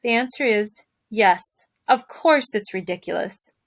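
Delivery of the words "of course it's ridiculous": usual speaking voice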